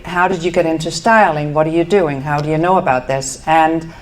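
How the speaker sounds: usual speaking voice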